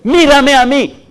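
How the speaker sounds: high, loud, slow call of mírame. Retold or imitated. Pastor voicing God's call loudly. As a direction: cry out mírame, stretching each word.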